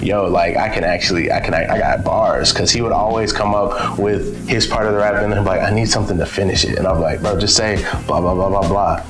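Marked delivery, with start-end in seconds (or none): none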